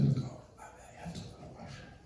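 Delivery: quick, whispered getaway line; hushed excuse. Acted. Husband excuses himself from angry wife to the washroom, hushed. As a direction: slip it in under your breath. Sneaking away from a quarrel.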